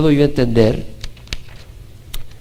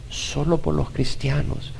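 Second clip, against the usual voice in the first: a much softer slow echo; softer, slow repeat; 9 dB quieter than usual. Shown as a demonstration. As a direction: let it fade almost to nothing. (repetido casi sin voz)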